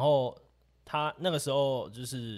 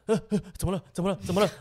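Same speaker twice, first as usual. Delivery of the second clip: higher and louder, jumpy repeated question; imitating startled panic; 8 semitones higher, 8 dB louder, faster than usual. Friend hearing don't look back, startled high panicked question. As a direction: blurt it like you just jumped up.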